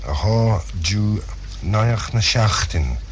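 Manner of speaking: usual speaking voice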